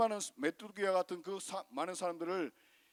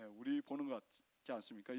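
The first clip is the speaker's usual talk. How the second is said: usual speaking voice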